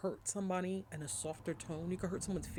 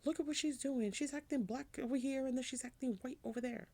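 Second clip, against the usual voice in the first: mock shocked crowd; 10 semitones higher than usual. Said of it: "everybody's like".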